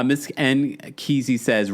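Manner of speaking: ordinary delivery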